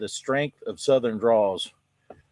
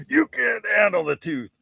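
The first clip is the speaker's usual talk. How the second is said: higher, punchy dramatic quote; mock movie line; 8 semitones higher than usual. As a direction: deliver it like a courtroom movie line.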